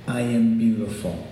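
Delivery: ordinary talk, nothing marked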